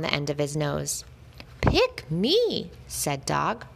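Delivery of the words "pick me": high, bright begging cry; a puppy begging to be chosen; eager plea. A narrator's reading. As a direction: beg to be picked, pitch way up.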